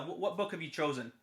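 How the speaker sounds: plain reading voice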